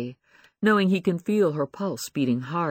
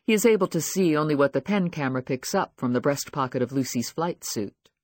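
ordinary delivery, nothing marked

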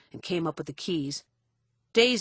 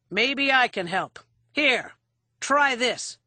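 raised, hard-edged sarcasm; menacing mock offer; 6 semitones higher, 4 dB louder, slower than usual. A narrator's reading. Bar man about to fling a drunk out, menacing. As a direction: offer help as a threat, pitched up.

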